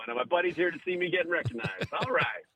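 ordinary talk, nothing marked

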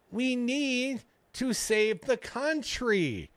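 high, very slow whine; Ron DeSantis whiny voice. Retold or imitated. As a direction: drag out each word like a whine. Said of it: "Ron DeSantis quotes".

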